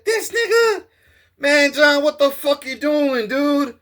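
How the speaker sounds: retold shout of disbelief; shocked outburst. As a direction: call out in shock at a friend. Retold confrontation.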